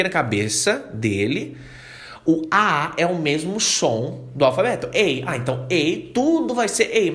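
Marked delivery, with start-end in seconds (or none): none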